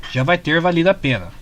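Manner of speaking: ordinary delivery, nothing marked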